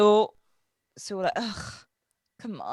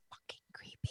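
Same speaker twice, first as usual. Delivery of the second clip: whisper; 20 dB quieter than usual. Acted. Describing creepy episode moment.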